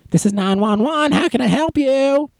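very high put-on operator greeting; hammed-up high-pitched dispatcher; imitated emergency operator. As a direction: answer the call in a high mock voice.

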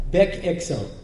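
"back axle" in an Israeli accent, like "bek eksel"; mock Israeli accent on "back axle", both words starting with "eh" sounds; Hebrew accent. Shown as a demonstration. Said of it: "English with a bad accent".

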